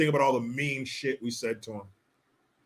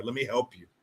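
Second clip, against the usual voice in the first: ordinary talk, nothing marked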